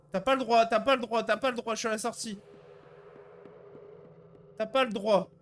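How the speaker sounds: loud, high desperate pleading; desperate protest. Real, not acted. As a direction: protest loudly in disbelief. Caught at the exit, protesting.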